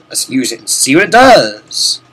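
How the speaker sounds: normal talking voice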